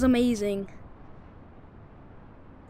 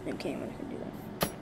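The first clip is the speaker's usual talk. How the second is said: whisper; 10 semitones lower, 11 dB quieter, much faster than usual. Real, not acted. Quick whispered aside.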